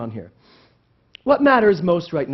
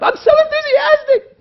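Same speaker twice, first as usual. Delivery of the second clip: very high, loud gushing squeal; mock over-the-top bubbly shout; bubbling enthusiasm; 10 dB louder than usual. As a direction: gush it loudly, sky-high and far too perky. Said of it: "standing here bubbling up".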